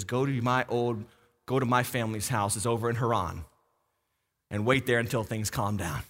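a mother quietly sending her son away; hushed motherly instruction. Retold or imitated. Mother sends son away secretly.

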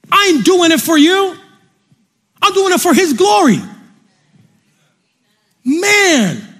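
high-pitched, emphatic preacher's proclamation; fervent preaching. Acted. Preacher refusing praise for the message, fervent. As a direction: drive each phrase home with fervor.

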